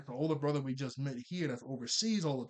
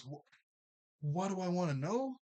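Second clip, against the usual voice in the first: imitating someone caught off guard, stumbling then pitched up; stammer, then a raised, mock-baffled question; choked up; 4 semitones higher than usual. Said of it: "he gets choked up".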